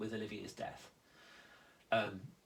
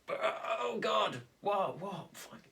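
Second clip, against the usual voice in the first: high, startled, confused voice; much higher, bewildered mock alarm; confused panic; 8 semitones higher, slower than usual. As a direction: play the primal instinct suddenly losing its footing. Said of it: "That's going".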